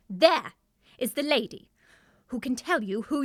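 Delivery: one loud, ringing word; dramatic declaration. Acted. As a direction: announce it like a grand revelation. Dramatically points out who knows.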